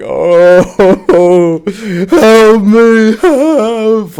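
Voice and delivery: high, loud begging whimper; pained plea. Retold or imitated. Rocking in the passenger seat, high pained begging. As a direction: beg for help through the pain. Retold pleading in pain.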